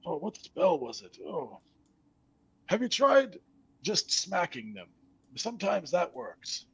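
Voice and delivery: high, rambling forgetful skeleton. Acted. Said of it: "He says".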